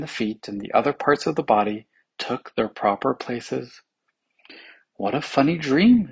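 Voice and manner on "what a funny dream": raised, louder exclamation; exclaiming. A narrator's reading. Officer wakes from his dream, exclaiming. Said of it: "exclaimed the officer".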